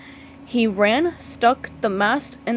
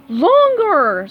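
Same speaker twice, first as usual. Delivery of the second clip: high, loud shout; 7 semitones higher, 7 dB louder than usual. Acted. Squidward shouting at Patrick during jumps, loud. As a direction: yell at him to stretch. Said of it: "Squidward shouted".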